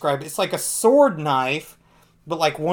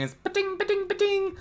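much higher pa-ting zap noises; zap gun sound; 3 dB quieter than usual. Retold or imitated. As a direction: make the ray gun go pa-ting. Imitating energy guns zapping.

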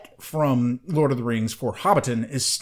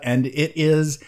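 normal talking voice

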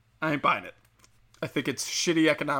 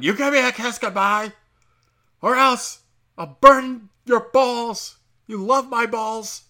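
loud, slow hammed-up yell, pitched up; mock yell; 8 semitones higher, 7 dB louder, slower than usual. To his girlfriend, a hammed-up ogre yell. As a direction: bellow the threat like a lovesick ogre.

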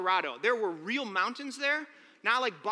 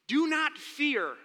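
softer, lower, slow comfort; tender reassurance; 3 semitones lower, 3 dB quieter, slower than usual. Acted. God gently comforting a scared Joshua, soft and slow. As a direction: speak it gently to a frightened child. Tender reframing of God's words.

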